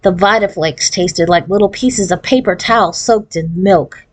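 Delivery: usual speaking voice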